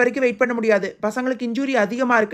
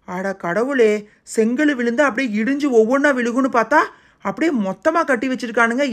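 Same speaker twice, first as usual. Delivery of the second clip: ordinary talk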